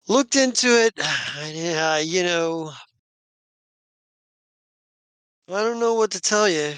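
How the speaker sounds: helpless shrug. Acted. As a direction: sigh mid-line, mildly helpless. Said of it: "a bit of a helpless smile and a shrug".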